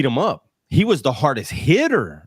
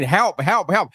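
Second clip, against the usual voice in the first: mock yell for help; 6 semitones higher, slower than usual. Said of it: "he's yelling".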